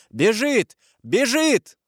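high-pitched guard's cry; shouted alarm. A narrator's reading. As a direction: raise the alarm with a drawn-out shout. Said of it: "и крикнули". (кричать, поднимая тревогу)

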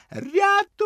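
very high desperate cry for help; крик про допомогу. A narrator's reading. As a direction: scream for help from the water. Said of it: "крик з озера". (відчайдушний крик «Рятуйте!»)

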